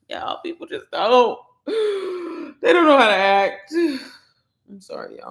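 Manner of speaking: worked up, sighing between phrases; a little emotional. Real, not acted. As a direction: sigh heavily, overcome for a moment. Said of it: "I'm a little emotional".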